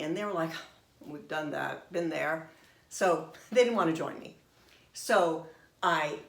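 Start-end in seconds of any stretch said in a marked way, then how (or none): 1.0-2.5 unimpressed. Retold or imitated. Daughters turn down the invitation.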